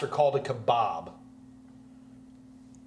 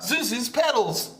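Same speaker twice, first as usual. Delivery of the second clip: bad Scottish accent; 9 semitones higher, 3 dB louder, much slower than usual. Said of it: "I'm not gonna do my bad Scottish accents".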